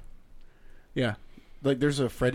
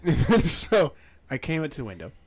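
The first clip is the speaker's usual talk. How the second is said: ordinary talk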